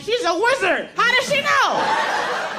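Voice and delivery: high, loud and drawn-out amazement; amazed women. Retold or imitated. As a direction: squeal it like a stunned fan. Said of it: "women are like".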